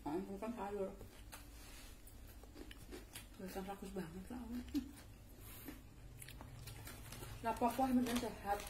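chewing while talking; speaking through a mouthful of food; mouth full. Real, not acted. Seen 2: Puffed cheeks, chewing after biting green vegetable throughout labelled words.

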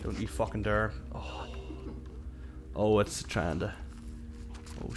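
ordinary delivery

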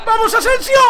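building high-pitched shout, last name drawn out; frenzied cheering. Real, not acted. Player urges Asensio towards goal, frenzied yelling.

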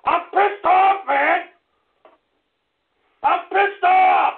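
real on-air fury; furious. Real, not acted. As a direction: spit each word out. Host raging on air.

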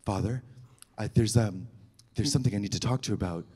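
hushed confession. Retold or imitated. Speaking to the priest, hushed and nervous. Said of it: "I was like".

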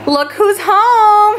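high, slow sing-song greeting; drawn-out cheerful welcome home; welcoming someone home. Real, not acted.

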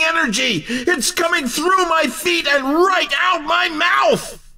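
high-pitched, hyped-up race call; about to burst with excitement; overexcited race commentator. Acted.

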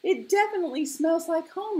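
high, loud, quick joy; happy. A narrator's reading. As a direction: burst out happily. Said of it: "he said happily".

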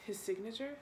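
ordinary talk

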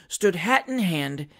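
ordinary delivery, nothing marked

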